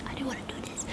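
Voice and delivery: faint unvoiced whisper of the inner voice; soft secret whisper. Shown as a demonstration. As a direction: barely breathe the words, like a small voice in your head. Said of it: "that voice was, like, like this".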